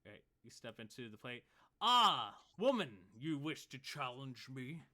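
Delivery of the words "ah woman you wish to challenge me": loud, scoffing, slow challenge; mocking champion. Half-orc scoffs at the woman stepping in, loud. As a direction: sneer at the challenger.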